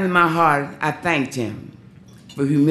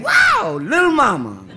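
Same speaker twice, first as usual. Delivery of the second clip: much higher, loud, laughing exclamation; excited shout; 5 dB louder than usual. Retold or imitated. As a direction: holler it in amazement at the dancer. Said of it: "he said".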